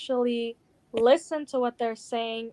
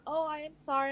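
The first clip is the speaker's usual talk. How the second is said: imitated timid apology, softer; unsure apology; 3 semitones higher, 7 dB quieter, faster than usual. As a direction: mimic someone shrinking into an apology. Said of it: "just so unsure about it".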